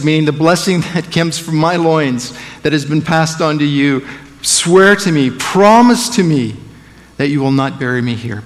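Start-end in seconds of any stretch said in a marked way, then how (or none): 4.4-6.6 louder and higher, pressing the oath; a dying father demanding a promise; urgent demand. Acted. Jacob on his deathbed urging Joseph, loud and pressing.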